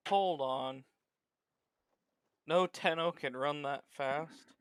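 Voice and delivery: ordinary talk, nothing marked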